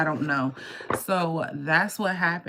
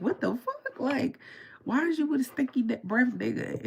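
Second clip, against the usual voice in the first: high-pitched mock disbelief; retold exasperation, pitched way up; incredulous outrage; 9 semitones higher than usual. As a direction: protest it high, appalled. Acted outrage over friend's boyfriend.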